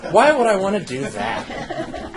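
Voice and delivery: high, slow laughing question. Real, not acted. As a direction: laugh through the joke. Joking reply to "sit down.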